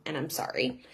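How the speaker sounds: soft, low mock apology; retold mother's quiet sorry; gentle apology.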